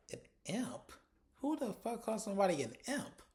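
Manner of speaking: high-pitched baffled questioning; amused disbelief. Retold or imitated. Viewer reacting to Stacey calling someone an imp, incredulous. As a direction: repeat the insult as if it's absurd.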